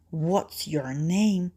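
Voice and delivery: slow sneaky whisper; sly whispering wolf. Acted. Wolf asks her name.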